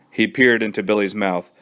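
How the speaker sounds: ordinary delivery, nothing marked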